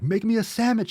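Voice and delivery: high-pitched mock demand; jokey bossy yell at a spouse; joking yell. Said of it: "I yell at Mama Soy".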